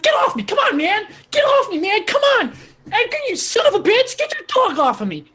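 very high, squawking protest; angry Weasel. Acted. Weasel pinned on the bar by Krakow, high angry yelling. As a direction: shout high and frantic while struggling.

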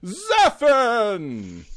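high, slow ring announcer build-up; hype announcer. Acted. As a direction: sell it like a boxing intro. Announcing Zephin like a boxer.

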